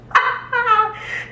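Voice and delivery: a high, breathy laugh while tearing up; high teary laugh. Real, not acted. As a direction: laugh through rising tears. Laughing while getting emotional.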